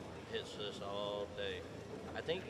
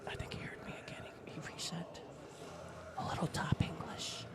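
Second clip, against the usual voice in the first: whispered commentary; whispering; 5 semitones higher than usual. Real, not acted. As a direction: hush it like golf.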